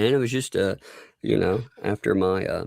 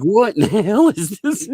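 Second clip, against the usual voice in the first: high, loud baffled retort; mock disbelief; 12 semitones higher, 5 dB louder than usual. Voicing an Aussie shown Outback food, mock disbelief. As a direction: imitate someone appalled, about to laugh.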